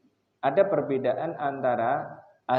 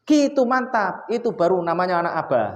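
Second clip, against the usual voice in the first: high, loud proud praise; 5 semitones higher, 5 dB louder than usual. Retold or imitated. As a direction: cheer the son on, much higher and louder. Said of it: "saya acungi jempol dia". (pujian bangga, tinggi dan keras)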